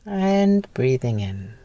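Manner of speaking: soft, unhurried breath cues; guided breathing. Acted. Yoga teacher calmly guiding the class's breaths. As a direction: ease the class through each breath. Leads breaths over music.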